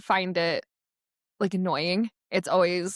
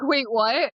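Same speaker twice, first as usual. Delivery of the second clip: higher, startled double-take; mock confusion; 5 semitones higher, 4 dB louder than usual. Unprepared staffer caught out on game day, mock surprise. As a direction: act caught off guard.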